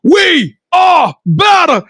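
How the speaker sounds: high, rousing sign-off, slowing near the end; show outro. Acted. Host signing off to the audience, rousing announcer.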